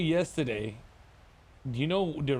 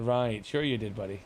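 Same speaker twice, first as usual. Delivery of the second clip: dry mocking lower voice; sarcastic; 8 semitones lower than usual. Calling out his friend's excuse, sarcastically. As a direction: say it like you don't buy it.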